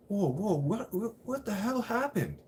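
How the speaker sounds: high, alarmed retelling of his courtroom shock; imitating his own panicked outburst at the judge; shocked disbelief. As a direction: yelp it out like the floor dropped away. Panicked reaction in court retold.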